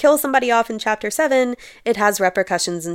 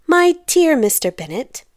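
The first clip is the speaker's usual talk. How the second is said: lightly voiced wife, pitched higher; wife addressing husband; 6 semitones higher, 3 dB louder than usual. A narrator's reading. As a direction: call to your husband as a story's wife. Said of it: "said his lady to him".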